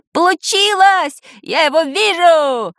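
high, slow triumphant cry; excited yell. A narrator's reading. Boy suddenly spots it on the floor, yelling. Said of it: "завопил вдруг Шурасик".

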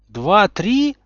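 high shocked echo; horrified question. A narrator's reading. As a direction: repeat it in horror, voice jumping up. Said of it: "с ужасом переспросил Гарри". (в ужасе переспрашивает)

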